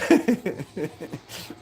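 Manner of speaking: pained laugh that hurts; laughing through pain. Real, not acted. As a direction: laugh while wincing in pain. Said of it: "Damn, it hurts to laugh".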